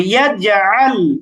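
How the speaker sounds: "ইয়াজআল" slowly, with echoed j; bouncing qalqalah. Shown as a demonstration. Teacher models jim with sukun, slow bouncing qalqalah. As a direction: bounce the j in "ইয়াজআল", then draw out the ending. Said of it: "কলকলা করতে হবে".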